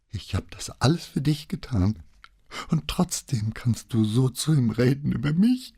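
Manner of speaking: high, tearful reproach; sobbing. A narrator's reading. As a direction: plead through heartbroken sobs. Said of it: "herzzerreißenden Schluchzen zu weinen". (weinend, mit hoher Stimme)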